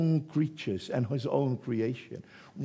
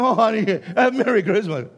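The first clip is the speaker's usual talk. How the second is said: higher, louder, laughing through a syrupy holiday greeting; sweet laughing make-up; 7 semitones higher, 7 dB louder than usual. Retold or imitated. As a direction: playfully mimic a husband suddenly sweet to his wife. Quarrelling couple makes up.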